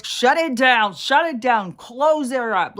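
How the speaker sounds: high, loud mock panic of online people. Mocking TikTok users who panic at a disagreement, loud and shrill. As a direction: ham up a frantic shutdown. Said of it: "start freaking out".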